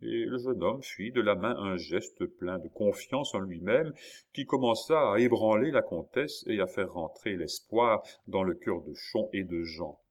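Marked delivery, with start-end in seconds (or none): none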